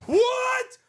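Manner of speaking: sudden high startled yell; shocked shout. Real, not acted. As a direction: blurt it out in shock. Shocked by clip starting with explosion.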